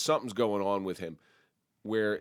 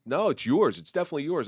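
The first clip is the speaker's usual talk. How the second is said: louder, mock insistent retort; insisting louder; 6 semitones higher, 7 dB louder than usual. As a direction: push back harder, half joking. Retold back-and-forth over jurisdiction.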